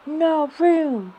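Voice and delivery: sudden loud high shout. A narrator's reading. Bear shouting at Alice to keep away. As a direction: bark it out to turn her away. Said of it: "the bear shouted".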